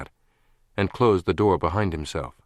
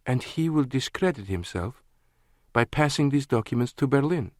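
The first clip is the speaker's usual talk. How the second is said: Lana's voice; 6 semitones higher than usual. A narrator's reading. Lana working it out.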